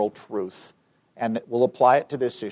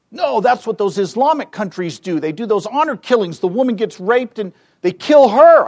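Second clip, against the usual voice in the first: high, louder outraged protest; indignant objection; 9 semitones higher, 8 dB louder than usual. Retold or imitated. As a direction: imitate an appalled woman protesting, building louder. Acting woman's indignant objection to question.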